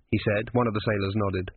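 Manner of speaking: plain reading voice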